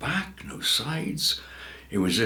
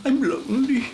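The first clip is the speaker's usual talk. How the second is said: tearful; 14 semitones higher, 4 dB louder than usual. A narrator's reading. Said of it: "she said".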